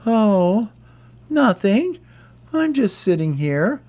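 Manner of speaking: very high, squeaky little bird voice, a bit dazed; Hope answering the gang in the theater, in a very high, squeaky voice; dreamy Hope. Acted. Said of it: "And Hope goes".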